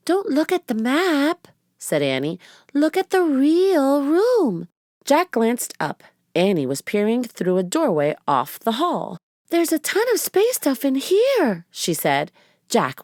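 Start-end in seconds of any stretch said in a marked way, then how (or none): 0.1-4.7 put-on a little girl correcting her brother. A narrator's reading. Narrator voices Annie. 9.5-11.7 put-on high excited girl voice; a delighted little girl. A narrator's reading. Narrator voices Annie.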